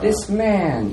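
high, emphatic put-on voice; Emily. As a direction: pitch it high for another character's voice. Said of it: "you hear Emily's voice say".

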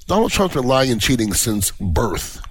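ordinary talk, nothing marked

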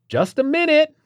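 loud, sharp, annoyed holler; impatient holler. Acted. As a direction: yell it back, losing patience. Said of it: "hollered again, this time less polite".

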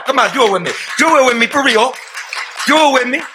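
urging crowd. Real, not acted. A speaker urging the audience to chant along. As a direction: pull the crowd in with you. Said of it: "urging crowd".

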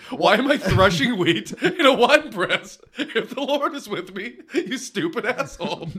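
a sarcastic rant breaking into laughter; cracking up while parodying Gideon's lament; laughing mock complaint.

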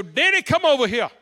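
loud call across the room. Retold or imitated. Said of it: "Vanessa go".

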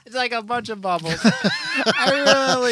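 high giggling words; laughing. Real, not acted. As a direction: laugh your way through it.

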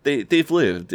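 put-on high-pitched defensive teen; imitated earnest protest. Neil defending the old people to Jay, high protest. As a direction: imitate a lad pleading his case, pitched high.

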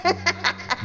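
put-on high little-girl giggle; a quick high giggle that falls away; a child giggling at the detective; girlish giggle. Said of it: "I heard her giggling".

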